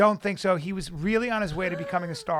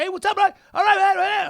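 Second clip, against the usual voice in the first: much higher, louder, wired actor voice; high guy greeting; 14 semitones higher, 11 dB louder than usual. Retold or imitated. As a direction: imitate the coked-up actor, buzzing. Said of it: "He's like".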